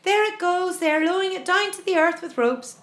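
high, loud running report; excited cry. A narrator's reading. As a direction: cry out what is happening. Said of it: "cried James".

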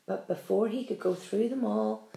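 ordinary delivery, nothing marked

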